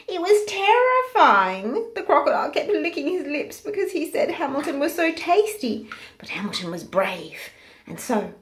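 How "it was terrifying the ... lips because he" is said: terrified. A narrator's reading. Pig climbing in jaws.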